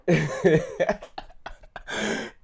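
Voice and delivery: a real high laugh breaking out; cracking up at the retold insult; burst of laughter. Real, not acted. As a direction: laugh hard at the joke. Reacting to funny retold line.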